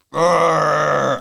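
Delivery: loud growl on waking; sudden growl out of sleep. Retold or imitated. Lad imitating his growl on waking up, loud. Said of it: "I think I just growled".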